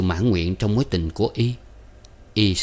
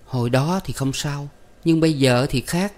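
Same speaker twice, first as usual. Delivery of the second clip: put-on sulky girlfriend; 6 semitones higher than usual. A narrator's reading. Narrator voices sulky young woman.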